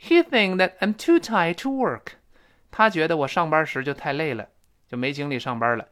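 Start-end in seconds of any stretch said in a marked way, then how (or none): 0.0-2.1 lifted, emphatic model reading with linked words; model sentence. Shown as a demonstration. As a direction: read it out higher and louder, dropping the end of "think that". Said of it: "然后说什么呢".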